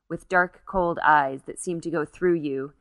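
usual speaking voice

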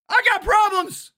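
high, exasperated outburst; real anger. Real, not acted. Man admitting his own problems, angry outburst. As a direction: blurt it out angrily, pitched high. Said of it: "I know I'm angry as fuck".

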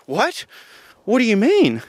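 put-on high-pitched kid's disbelief; imitating dismayed child. Niece hearing there is no food for her, imitated high dismay.

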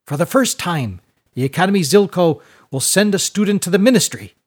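high, loud proud proclamation; bouncing with pride as you announce it; elated rector. A narrator's reading. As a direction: schoolmaster rallying the whole class.

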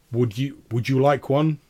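big, projected character voice; booming baron. Acted. Baron offering the tailor one, booming.

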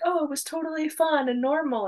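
high, airy mock-casual voice; mock breezy quote. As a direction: make it sound chirpy and carefree, mocking. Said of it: "they're like".

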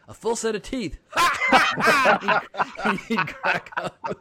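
punchline dissolving into high laughter; laughing punchline. Retold or imitated. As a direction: laugh out the last words as you tell it. Grandfather's joke punchline, laughing.

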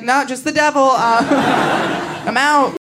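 lightly voiced grandma signing off; high breezy grandma. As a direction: toss it off high and casual. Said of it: "She's like".

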